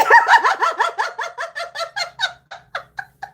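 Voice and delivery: high-pitched laugh; high laughter. Real, not acted. Laughing hard.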